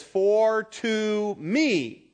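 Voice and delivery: "to me" drawn out slowly, high and loud; emphatic TO ME. Shown as a demonstration. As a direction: stretch and punch "to me". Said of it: "in the Greek that's called emphatic".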